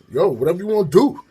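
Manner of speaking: loud, high mock-tough challenge; talking tough. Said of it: "talking tough would do something".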